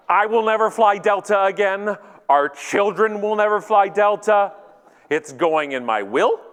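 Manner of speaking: high, loud, emphatic vow; mock anger. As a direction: declare a lifelong boycott in hammed-up indignation. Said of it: "stage twenty of Canadian anger".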